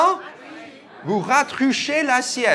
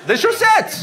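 surprised blurt; 12 semitones higher than usual. Real, not acted. Mishears word, blurts joke.

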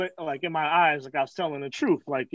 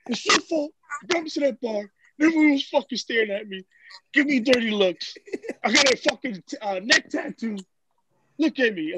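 higher-pitched, self-pitying blame; hammed-up mimic of his wounded complaining; impression of aggrieved friend; 5 semitones higher than usual. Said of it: "this is what it looked like".

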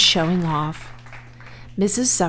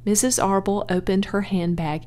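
ordinary delivery

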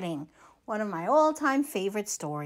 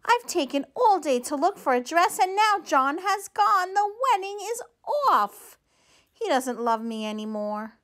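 a touch of storybook tears, climbing higher; rising, tearful self-blame; mildly sobbing out the sad news; 6 semitones higher than usual. Acted. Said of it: "sobbed Josephina".

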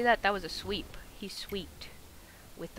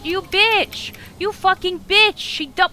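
much higher, loud furious scream; furious shout; 13 semitones higher, 17 dB louder than usual. Real, not acted. Player screaming at the boss who just hit them, furious. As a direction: scream your rage at the boss.

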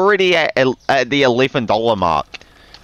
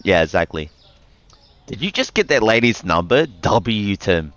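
normal talking voice

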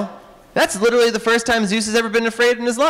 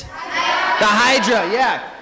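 normal talking voice

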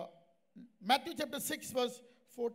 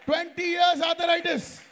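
loud, high, slow declaration; emphatic proclamation; 4 semitones higher, 9 dB louder than usual. Real, not acted. Preacher telling congregation of long illness, emphatic. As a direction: preach it at full force.

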